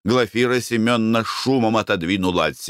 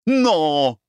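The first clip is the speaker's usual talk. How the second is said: curt, higher-pitched no; sullen refusal; 8 semitones higher than usual. A narrator's reading. Wife refuses the beauty's question, sullen and curt. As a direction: cut them off sullenly. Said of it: "угрюмо отрезала Глафира Семёновна".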